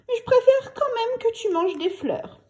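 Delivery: put-on a girl's teasing reply; lightly voiced child; little girl. A narrator's reading.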